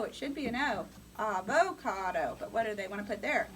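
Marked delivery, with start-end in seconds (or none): 1.2-2.4 avocado slow, with a clear long o in the middle; a-vo-cado, stretching the O; spelling pronunciation. Shown as a demonstration. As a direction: slowly sound out the O in Avocado. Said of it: "It's an O".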